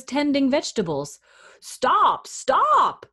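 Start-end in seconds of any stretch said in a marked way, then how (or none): 1.6-3.0 high-pitched storybook shout; crying out. A narrator's reading. Husband spots the runaway gingerbread man, crying out. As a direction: call out in two rising bursts. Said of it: "he cried when he saw".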